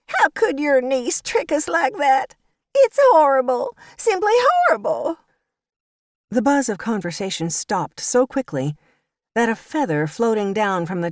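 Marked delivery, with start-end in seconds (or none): 0.0-5.2 high, loud mock-distraught wail; Violet crying loudly. A narrator's reading. Violet performs fake tears for the churchgoers, high wail. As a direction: ham up the fake grief, pitched high. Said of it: "she cried in a loud voice".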